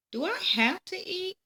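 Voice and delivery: put-on high, reluctant little-girl plea; whiny child. A narrator's reading. Peggy pleading with her mom to skip dinner, high and whiny. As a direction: whine like a kid who'd rather play. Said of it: "Peggy asked".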